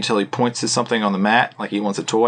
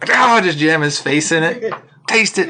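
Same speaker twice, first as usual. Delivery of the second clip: mock gloating shout; 6 semitones higher, 4 dB louder than usual.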